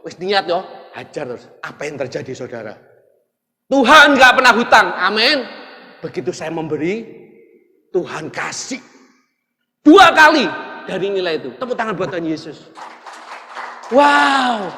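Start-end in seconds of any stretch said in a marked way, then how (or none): 3.7-5.4 loud, higher proclamation; preaching shout. Real, not acted. Preacher proclaiming to the congregation, loud and high. 9.8-10.5 "dua kali" shouted high and loud; stress on dua kali. Real, not acted. Preacher stressing "dua kali" to the congregation, loud.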